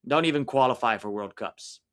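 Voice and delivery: ordinary talk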